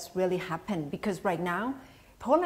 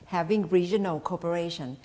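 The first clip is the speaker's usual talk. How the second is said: normal talking voice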